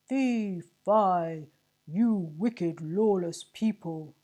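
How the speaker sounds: loud, slow, booming bellow; bellowing sergeant. A narrator's reading. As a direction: roar it at the crowd. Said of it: "bellowed the sergeant".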